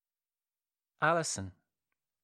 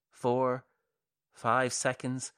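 ordinary delivery, nothing marked

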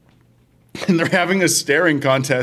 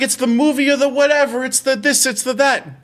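high, quick parody of gushing fans; mocking hype; 6 semitones higher than usual. Film hater quoting what everyone says about Oppenheimer, mocking hype. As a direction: rattle off the praise in a raised mock voice.

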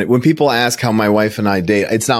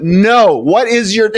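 high, louder exasperated demand; mock scolding; 12 semitones higher, 4 dB louder, slower than usual. Woman demanding his date's name, high mock exasperation. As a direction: imitate her insisting, pitched well up. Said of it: "chewed me out".

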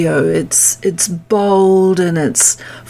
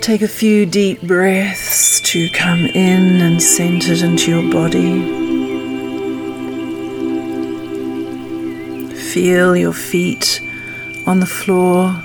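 calm grounding exercise. Acted. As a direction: guide them gently into stillness. Leads a meditation.

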